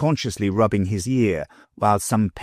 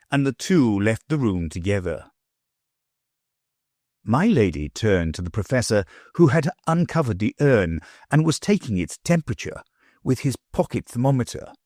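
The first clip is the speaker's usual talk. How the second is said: plain reading voice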